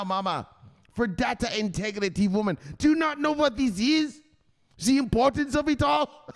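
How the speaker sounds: an obsessed crypto son lecturing his mother; hardcore son. Retold or imitated. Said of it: "He says".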